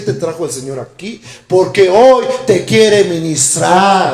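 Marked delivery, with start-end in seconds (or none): 1.5-4.1 slow, loud, climbing preaching; fervent preaching. Acted. Preacher exhorts the congregation, slow, loud and climbing. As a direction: drive it home from the pulpit.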